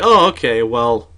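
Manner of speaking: loud, preachy teacher lecture; lecturing teacher. Retold or imitated. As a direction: lecture like an over-the-top teacher. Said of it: "and she was like".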